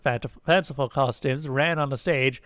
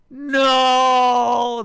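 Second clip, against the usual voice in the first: loud, high, long-held scream; theatrical villain's scream; 11 semitones higher, 8 dB louder than usual. A narrator's reading. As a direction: wail it out in mock horror. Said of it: "the Kamath screamed".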